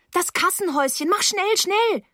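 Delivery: high voice climbing in panic; desperate girl pressing for help; frantic urging. Acted. Frantic girl urges him to hurry.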